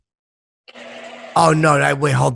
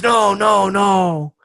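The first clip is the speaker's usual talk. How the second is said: lightly voiced French tourist, pitched up; a hint of a refusing Frenchman, higher than usual; French guy refusing; 5 semitones higher, much slower than usual. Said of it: "The French guy goes".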